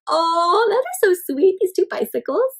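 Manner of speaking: plain reading voice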